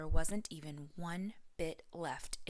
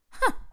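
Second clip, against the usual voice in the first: scoffing huh; 11 dB louder than usual. Acted. Mama Bear scoffs.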